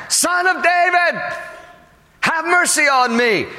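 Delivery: long, high-pitched call over the crowd. Acted. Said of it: "all the louder it says, he cries out again".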